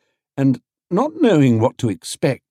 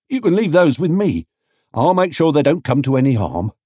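higher, louder trader voice; an obliging stallholder, pitched up; man offering help, higher; 6 semitones higher, 3 dB louder than usual. A narrator's reading. Man offering to mind water.

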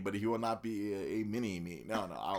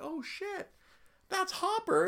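very high, excited mock gasp of recognition; high-pitched, giddy discovery; retold amazed realization; slower than usual. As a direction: act out sudden recognition, pitched way up. Acting out his surprised recognition.

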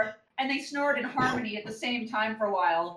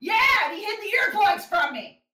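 high, loud, fast outburst; indignant; 9 semitones higher, 6 dB louder than usual. Real, not acted. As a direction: protest it loudly. Outraged reply.

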